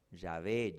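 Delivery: "Yahvé" más grave, como "sha-BÉ"; pronunciación de Yahvé. Shown as a demonstration. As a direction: di "Yahvé" en dos sílabas, "sha-BÉ". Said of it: "Se pronuncia". ("Yahvé" grave, con "b" suave)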